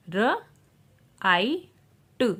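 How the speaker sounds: र, आई, ट as three separate sounds; sounding out. Shown as a demonstration. Teacher showing IGH words, sounding out र, आई, ट. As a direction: pause after each: र, long आई, ट. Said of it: "आई का जो vowel sound long".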